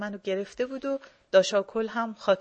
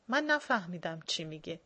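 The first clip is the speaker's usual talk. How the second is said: ordinary delivery, nothing marked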